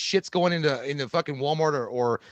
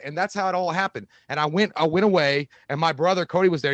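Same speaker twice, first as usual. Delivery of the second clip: usual speaking voice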